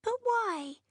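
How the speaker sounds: put-on high, bewildered little girl; a child on the verge of tears asking why; tearful child's question. A narrator's reading. Jenny asks her mother why Goldie died, high child's voice.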